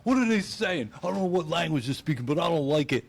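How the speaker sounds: hammed-up yelling at a table; mock angry yelling. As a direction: yell at the table like the angry comic. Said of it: "He's yelling at a table".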